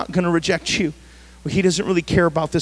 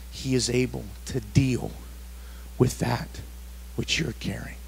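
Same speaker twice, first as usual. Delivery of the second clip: whisper; 6 semitones lower, 11 dB quieter than usual. Real, not acted. Fading intimate close.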